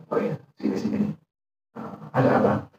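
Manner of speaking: very soft, near-whispered calling over; hushed welcome. Retold or imitated. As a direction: beckon him in almost under your breath. Umar calls the companion over. (panggilan lembut setengah berbisik)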